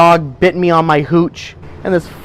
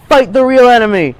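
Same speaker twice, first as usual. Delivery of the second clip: yelled slogan; 5 semitones higher than usual. Retold or imitated. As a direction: yell it at the crowd like a battle cry. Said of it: "And I yelled".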